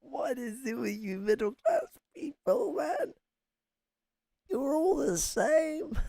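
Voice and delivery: high voice breaking into laughter; laughing mockery. Real, not acted. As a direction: scold them while helplessly cracking up. Mocking the clip while laughing.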